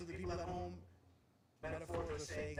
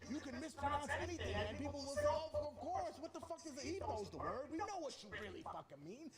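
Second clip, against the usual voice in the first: much higher, lively mock reaction; animated mock; 9 semitones higher than usual. Rapper mocks how English listeners forgive mispronunciation, high and lively. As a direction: sell it as an amused listener, pitched high.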